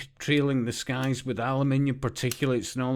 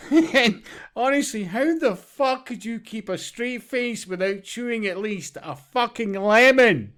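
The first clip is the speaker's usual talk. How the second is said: laughing; 6 semitones higher than usual. Real, not acted. Laughing at straight-faced manager.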